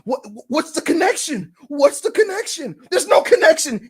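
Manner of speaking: high, rising to a loud outburst; exasperated rant. Real, not acted. Host fed up with the cryptic hints, exasperated. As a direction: demand it, then explode.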